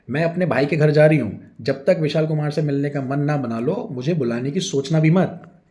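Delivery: loud angry ultimatum. A narrator's reading. Wife packing, giving husband an angry ultimatum. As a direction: lay down the ultimatum hotly. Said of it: "गुस्सा करते हुए".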